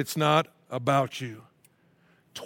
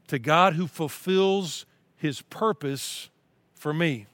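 slow, earnest reading of a psalm; scripture reading; slower than usual. Acted. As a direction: read it as a heartfelt prayer.

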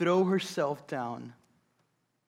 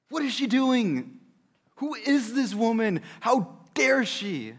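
indignant, raised voice, pitched up; a scandalized guest at the dinner; shocked onlooker; 7 semitones higher, 5 dB louder, slower than usual. Acted. A preacher voicing the shocked guests.

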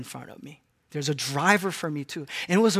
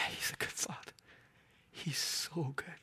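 very soft, unvoiced awe; reverent whisper; 2 semitones lower, 17 dB quieter than usual. Real, not acted. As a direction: whisper it as if in worship. Preacher moved after voicing God.

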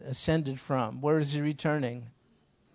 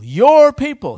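"your" punched much higher and louder; stress on your; 11 semitones higher, 15 dB louder, slower than usual. Retold or imitated. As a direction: hit "your people" hard and high, pointedly. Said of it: "he said it like that".

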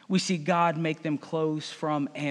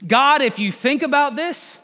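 higher, louder pleading appeal to God; Adam arguing loudly; 6 semitones higher, 6 dB louder than usual. Acted. Adam pleading with God, loud and high. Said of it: "The man replied".

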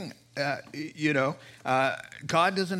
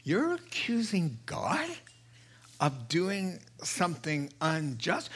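a preacher voicing Paul's outrage; Paul scolding, mock indignant; incredulous rebuke; 6 semitones higher than usual. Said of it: "Paul is like".

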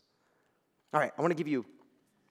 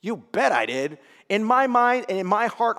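ordinary talk, nothing marked

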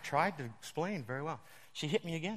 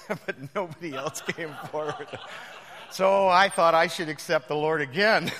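laughing through the words, louder; 2 semitones higher, 12 dB louder than usual. Real, not acted. Preacher laughing loudly at his own altar call story. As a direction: crack up at your own joke.

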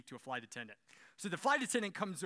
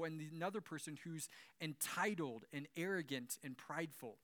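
ordinary delivery

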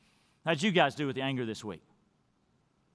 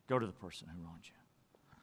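the Bible line again, much softer and lower; soft repeat; 10 semitones lower, 13 dB quieter than usual. Retold or imitated. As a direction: let the repeat sink to a hush. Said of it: "When the Bible says".

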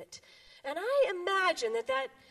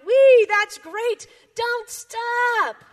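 high, loud gleeful cheer; frog cries out; 9 semitones higher, 12 dB louder, much slower than usual. Acted. Frog cheers the other animals, high and loud. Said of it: "Frog cried".

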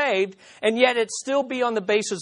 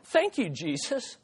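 quiet thought; 7 dB quieter than usual. Retold or imitated. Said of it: "And I thought".

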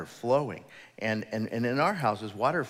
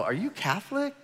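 joking question; 11 semitones higher than usual. Retold or imitated. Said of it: "And I'm all".

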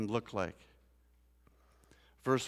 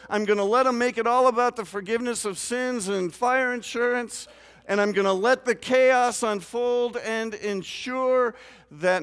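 higher, louder preaching at full force; 9 semitones higher, 8 dB louder than usual. Acted. Pastor warning his congregation about chaos, fervent loud preaching. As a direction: build the sermon to a fervent climax.

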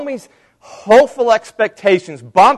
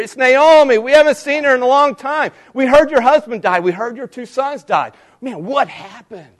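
gossiping bloggers; 7 semitones higher than usual. Retold or imitated. Bloggers gossiping about Naomi's return, high and fast. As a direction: play the nosy bloggers spreading the news. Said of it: "all the bloggers would be going nuts".

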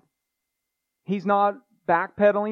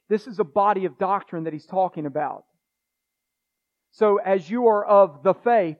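usual speaking voice

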